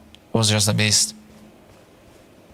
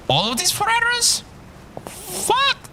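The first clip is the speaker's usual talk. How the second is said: shocked. Real, not acted. Reacts to enemy attack.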